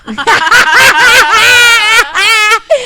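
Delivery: loud high burst of laughter; cracking up out loud. Real, not acted. Reacting to trailer joke.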